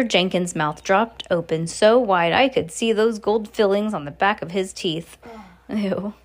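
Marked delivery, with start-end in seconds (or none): none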